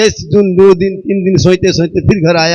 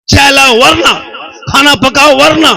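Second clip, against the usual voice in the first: mocking husband's orders; 7 semitones higher, 4 dB louder than usual. Wife mimics husband's threats.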